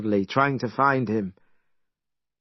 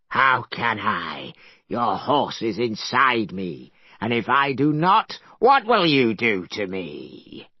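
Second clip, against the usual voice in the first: dragon voice; 2 semitones lower than usual. Acted. Dragon taunts Monkey.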